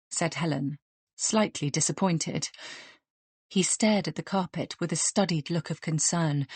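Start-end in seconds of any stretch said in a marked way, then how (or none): none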